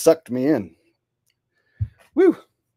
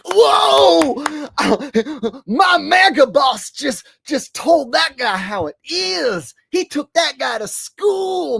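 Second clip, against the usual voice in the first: high-pitched, starstruck mock fan; hammed-up fan cheering his boss on; squealing, awed supporter voice; gushing Trump fan; 10 semitones higher, 5 dB louder, slower than usual. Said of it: "all the Trumpers are like".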